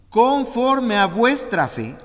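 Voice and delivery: slow, high, loud pronouncement; weighty solemn declaration; proclaimed scripture. Acted. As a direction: declare it like a verdict. Proclaiming Jesus' words.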